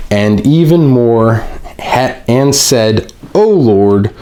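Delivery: slow, deliberate re-reading of the verse; slowed-down scripture reading. Shown as a demonstration. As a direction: take it again slowly, word by word. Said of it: "I'll read it a little bit slower".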